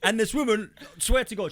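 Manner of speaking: normal talking voice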